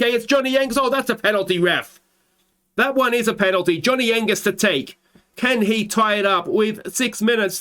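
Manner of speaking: high, urgent commentary; excited penalty call. Real, not acted. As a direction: plead for the penalty. Penalty won late on.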